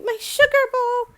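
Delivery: high, loud lament; distressed wail. Acted. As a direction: cry out over the broken bowl. Mother wails over broken bowl.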